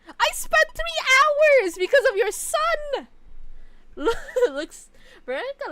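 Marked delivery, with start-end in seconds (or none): 0.1-3.1 high, slow, shrieking yell; screeching complaint. Acted. Yelling at game character. 3.1-4.5 high laugh. Real, not acted. Laugh after yelling.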